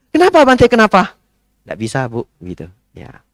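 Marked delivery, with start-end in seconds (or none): 0.1-1.1 very high, loud pleading why; imitating the insistent lady, high and loud. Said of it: "Bu, jam empat saya ndak bisa". 1.7-2.2 softer, flat no; quiet gentle no; calm refusal. Shown as a demonstration. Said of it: "Sambil senyum ya".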